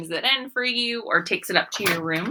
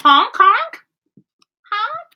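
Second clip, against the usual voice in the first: goose honks; 7 dB louder, slower than usual. Acted. Geese return.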